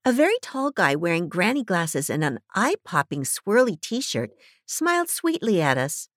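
plain reading voice

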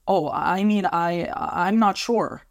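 high, faltering, thrown by shock. A narrator's reading. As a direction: stumble for words, still shocked. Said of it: "not being able to hide the shock in his voice".

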